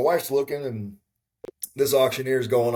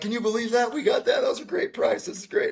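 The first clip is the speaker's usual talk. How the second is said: fired-up wife; 13 semitones higher than usual. Retold or imitated. Wife celebrating her winning bid to husband, imitated excitement. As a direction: gush over the win in a high mimicked voice. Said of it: "she's fired up. She's whooping and hollering".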